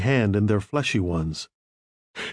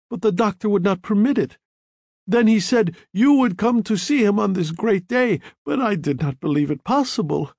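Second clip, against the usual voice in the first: higher, eager babbling widow; 7 semitones higher than usual. A narrator's reading. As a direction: pour it out in a rush. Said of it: "She babbled on".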